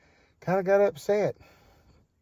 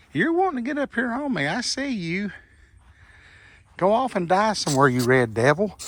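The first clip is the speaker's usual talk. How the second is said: taunting a wasp; 5 semitones higher than usual. Real, not acted.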